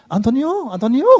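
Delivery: a light imitation of a teenager calling a name, pitched high; teen calling the pastor. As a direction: call the pastor's first name casually, like a kid. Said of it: "이름 부른다니까". (고등학생이 목사 이름을 가볍게 부르는 흉내)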